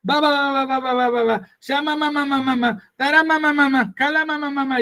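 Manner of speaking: high, mocking babble of a scoffer; mocking critic. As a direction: mimic a critic making fun of tongues. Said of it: "y se burla".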